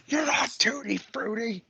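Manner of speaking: high whispered through laughter; laughing whisper. Real, not acted. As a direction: wheeze the line out laughing.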